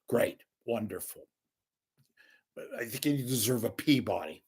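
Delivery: ordinary talk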